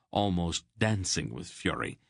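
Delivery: usual speaking voice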